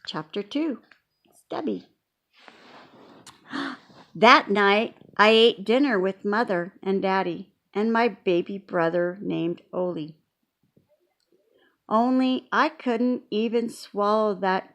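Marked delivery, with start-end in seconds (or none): none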